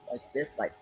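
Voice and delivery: low, soft voice; very deep. Shown as a demonstration. Demonstrating the old lady character, very deep voice. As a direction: drop right down into a deep voice. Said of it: "she talks very, very deep".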